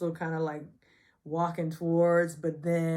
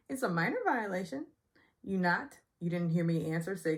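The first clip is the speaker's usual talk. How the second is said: normal talking voice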